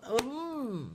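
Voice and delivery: hand over mouth. Real, not acted. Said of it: "let me just put my hand over my mouth".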